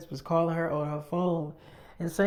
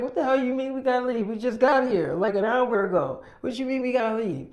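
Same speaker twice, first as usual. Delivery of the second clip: higher, indignant mock protest; 6 semitones higher than usual. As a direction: retell friends objecting to being sent out. Friends protest being told to leave.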